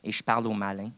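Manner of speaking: usual speaking voice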